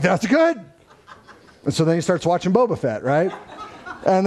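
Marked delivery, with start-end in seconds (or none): 0.0-0.6 high, punched-up encouragement; retold relieved cheer. Dad to son who feels no stitches, relieved. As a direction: answer the kid with upbeat relief.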